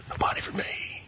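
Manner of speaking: sighed whisper, voice gone; whispered lament. Acted. Preacher voicing lonely Adam among the animals, whispered. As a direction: breathe it out, lonely and defeated.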